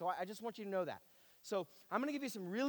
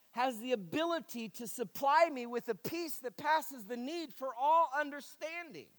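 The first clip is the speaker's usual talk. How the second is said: raised, emphatic preacher's voice; impassioned preaching; 7 semitones higher, 7 dB louder than usual. Acted. Preacher confessing his struggle to the congregation, impassioned. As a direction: preach it high, stretching the key words.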